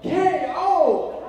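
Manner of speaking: high, loud announcer KO; crowd yell. Retold or imitated. Retelling the crowd's response, loud KO announcer call. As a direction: call it like the fight's over. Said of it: "the whole crowd yelled".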